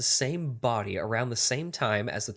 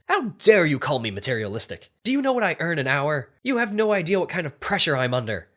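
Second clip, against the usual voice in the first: loud, high-pitched outrage; incensed; 5 semitones higher, 6 dB louder than usual. Acted. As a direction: play an indignant lawyer in a joke, voice raised. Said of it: "The lawyer became incensed and said".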